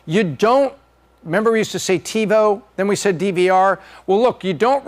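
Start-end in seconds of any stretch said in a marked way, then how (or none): none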